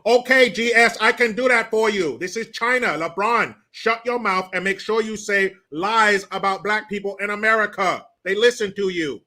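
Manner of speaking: playing China scolding LeBron, hammed-up; mock China voice. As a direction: ham up a bossy foreign power. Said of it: "your China talking impersonation".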